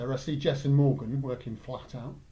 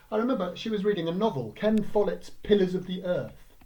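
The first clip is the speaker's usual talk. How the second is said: usual speaking voice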